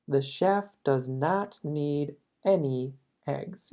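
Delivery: slow, careful English read; slow model sentence. Shown as a demonstration. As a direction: teach it word by word. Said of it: "Voy a repetir eso". (frase modelo, lenta)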